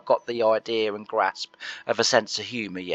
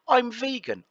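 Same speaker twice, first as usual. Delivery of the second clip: mock neighbour; 13 semitones higher than usual. Neighbour voiced in story.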